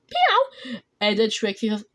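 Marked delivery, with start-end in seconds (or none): 0.1-0.8 very high little cat voice; high, squeaky pretend meow; kitten meow. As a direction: mew like a kitten. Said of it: "Then Marshall Kitty said".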